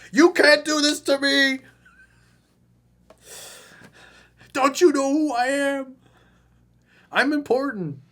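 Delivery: hammed-up screaming fit at her; mock tantrum rant. Coach mocking the client's outburst, loud high hammed-up rant. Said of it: "And what you did was like".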